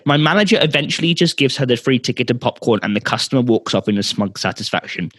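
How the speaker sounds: ordinary talk, nothing marked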